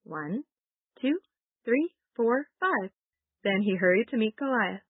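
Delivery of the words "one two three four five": counting aloud. Shown as a demonstration. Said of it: "Let's count them".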